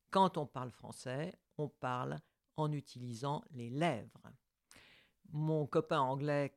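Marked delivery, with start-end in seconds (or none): none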